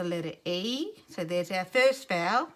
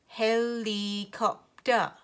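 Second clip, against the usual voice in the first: HELICOPTER slow, syllable by syllable; robot HELICOPTER in four separate beats; robot talk; much slower than usual. Shown as a demonstration. Teacher doing it again for the class, slow chunked robot HELICOPTER. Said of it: "say it slowly like a robot".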